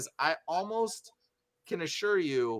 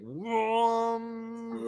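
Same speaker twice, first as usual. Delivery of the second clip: long, drawn-out horn whoom; imitated horn blast; 7 semitones higher than usual. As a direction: mimic one big resonating horn blast. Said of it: "blows the horn".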